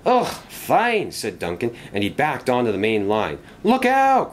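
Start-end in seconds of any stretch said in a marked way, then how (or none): 0.1-1.1 high, exasperated giving in; an annoyed engine's grudging consent; grudging irritation. A narrator's reading. Duncan reluctantly lets another engine through, exasperated. 3.6-4.3 high, loud warning shout; alarmed cry. A narrator's reading. Engine cries out while topping the mountain hill, alarmed. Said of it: "cried Bertram".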